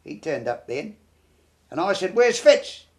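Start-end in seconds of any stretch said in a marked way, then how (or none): 2.1-2.9 raised, higher-pitched urgent question; urgent call. Retold or imitated. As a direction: call out for a missing mate, retelling it. Retold urgent question in battle.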